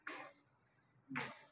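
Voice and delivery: खस breathed out as a voiceless whisper; खस as a soft hushed letter sound. Shown as a demonstration. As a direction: sound out खस softly, unvoiced. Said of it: "उसकी sound है खस".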